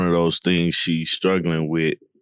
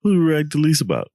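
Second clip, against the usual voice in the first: high, fast game-show question; mock quiz host; 10 semitones higher, much faster than usual.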